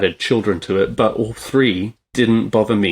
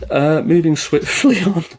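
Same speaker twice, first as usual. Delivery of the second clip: pitched up, words broken by a laugh; laughing through it; 9 semitones higher, 4 dB louder than usual. Real, not acted. Host laughing as she moves on from the tearjerker list. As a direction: giggle while pushing on to the next topic.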